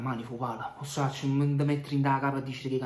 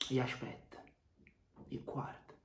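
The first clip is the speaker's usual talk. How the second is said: soft, slow threatening hush; menacing whisper; 10 dB quieter, slower than usual. Acted. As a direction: promise danger under your breath. (sussurro minaccioso)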